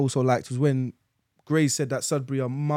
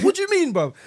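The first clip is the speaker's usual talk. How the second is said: indignant question; 12 semitones higher than usual. Retold or imitated. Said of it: "I said".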